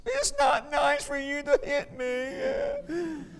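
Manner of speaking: lightly mocking small-child voice; little child's retort. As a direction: answer back like a sulky two-year-old. Child answers father in story.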